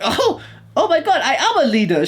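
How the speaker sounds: high, laughing imitation of delight; mock amazed test-taker. Mocking someone told they are a leader, exaggerated gushing. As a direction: play a thrilled test-taker, way over the top.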